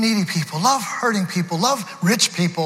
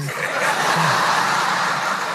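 low breathy chuckle; 4 semitones lower than usual. Real, not acted. Laughs at his discernment.

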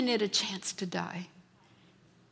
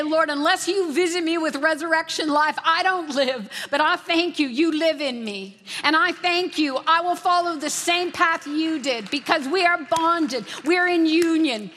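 fervent prayer; 4 semitones higher than usual. Acted. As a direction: pray it out with rising fervor. Voices a prayer.

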